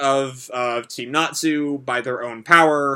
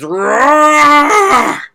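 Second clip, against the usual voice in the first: much higher and louder anime scream; screamed roar; 12 semitones higher, 10 dB louder than usual. Acted. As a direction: scream it like a dragon. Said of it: "As Natsu screams".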